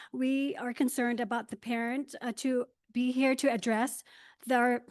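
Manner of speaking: hoarse, fading voice; losing my voice. Real, not acted. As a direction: voice almost gone, pushing through. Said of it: "I'm losing my voice".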